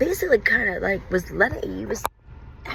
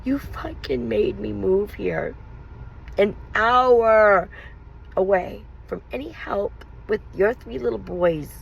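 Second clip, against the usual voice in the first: bitter accusation; 5 semitones higher, slower than usual. Retold or imitated. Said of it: "I said".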